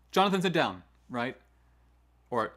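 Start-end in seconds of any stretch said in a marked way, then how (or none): 0.1-0.8 loud, run-together order; rushed, jumbled command. Shown as a demonstration. Teacher ordering Jonathan to sit, loud and rushed. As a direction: blurt it loudly in one go. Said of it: "not talking slow".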